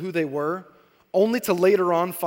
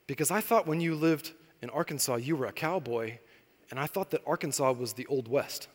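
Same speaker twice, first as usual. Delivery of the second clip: put-on soft, much quieter little-girl reasoning; hushed earnest child; daughter's voice; 4 semitones lower, 8 dB quieter than usual. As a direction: explain innocently like a small child. Daughter explains her idea.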